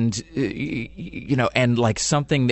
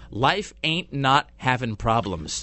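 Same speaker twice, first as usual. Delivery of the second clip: slow, word-by-word echo; drawn-out imitation of a TV line; deliberate mimicry; 2 semitones higher, much slower than usual. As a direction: repeat it slowly as a mock quote. Repeats the clip's line mockingly.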